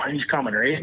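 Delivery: ordinary talk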